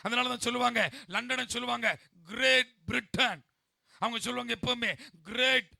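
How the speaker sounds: loud, driving sermon delivery; full-force preaching. Acted. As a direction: preach it out loud to the whole congregation.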